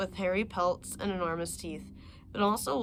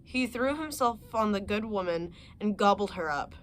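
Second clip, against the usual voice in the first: plain reading voice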